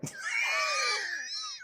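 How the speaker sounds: high laughing squeal. Real, not acted. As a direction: burst out laughing. Laughing at own story.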